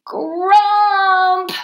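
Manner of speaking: high, loud grumpy cry; storybook child's loud grumble; grumpy outburst. A narrator's reading. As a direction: let the grump burst out, drawn long. Child's grumpy cry grows louder.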